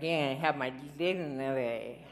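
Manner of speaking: put-on slow, slurred drunken boast; lightly slurred tipsy claim; mock drunk. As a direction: slur it like a drunk insisting on dignity. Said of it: "try saying that when you're drunk".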